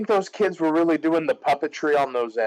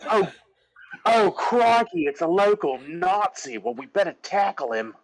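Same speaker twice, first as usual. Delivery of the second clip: mock Australian accent; 6 semitones higher than usual. Bert spotting a local Nazi, hammed-up Australian accent. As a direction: ham it up as a Cockney turned Australian. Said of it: "I'm Australian now".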